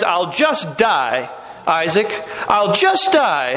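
drawn-out mock despair; melodramatic wife. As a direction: imitate a wife's guilt trip, stretching the words slowly. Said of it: "woman talk to you like that".

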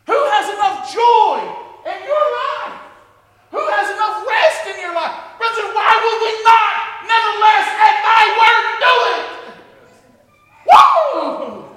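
loud, high rousing preacher's cry; full-force preaching. Acted. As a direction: preach it out to the rafters.

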